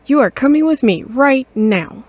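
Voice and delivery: loud, scolding mother cat. Acted. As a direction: tell off your grown son. Mother scolding her son.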